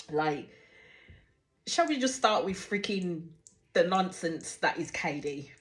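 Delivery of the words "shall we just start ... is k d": ordinary talk, nothing marked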